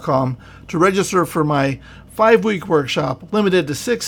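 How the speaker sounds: radio host outro. Acted. Host plugging his workshop, loud announcer read. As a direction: pitch the workshop to listeners.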